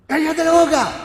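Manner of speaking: high, sharp mock scolding; regaño de los discípulos. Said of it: "los discípulos retaban al ciego". (regañando en voz aguda)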